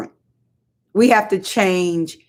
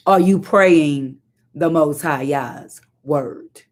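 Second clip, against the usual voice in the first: slow pointed question; 3 semitones lower, much slower than usual. Shown as a demonstration. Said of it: "preaching slow question".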